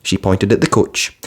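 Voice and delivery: ordinary delivery, nothing marked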